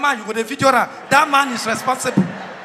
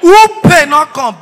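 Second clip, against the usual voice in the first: shouted call; 4 semitones higher, 11 dB louder than usual. Acted.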